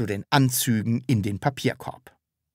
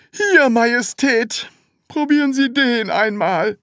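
weary; 12 semitones higher, 5 dB louder than usual. A narrator's reading. Seller offers another suit, weary. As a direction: hand it over wearily in a high voice. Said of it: "sagte er matt".

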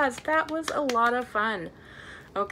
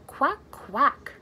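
quacking. Pretend. Pretending to be a duck.

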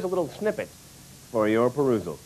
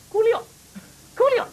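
[name] squeaked much higher; high squeaky repeat; 14 semitones higher, 4 dB louder than usual. Shown as a demonstration. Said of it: "uh, [name]".

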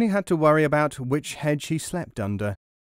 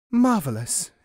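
gasped delight; 6 semitones higher, 3 dB louder than usual. Acted. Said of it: "gasped the disciple".

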